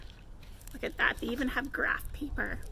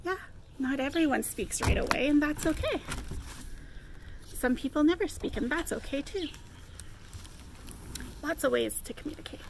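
usual speaking voice

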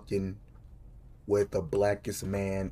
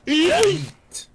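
yelled yeet; 10 dB louder than usual. Real, not acted. Yelling while playing.

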